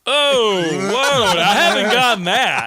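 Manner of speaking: loud, high astonished whoa; loud amazed exclamation; real surprise. Real, not acted. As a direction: react stunned to the news. Astonished reaction.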